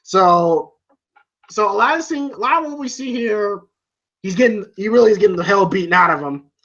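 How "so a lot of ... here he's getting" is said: ordinary delivery, nothing marked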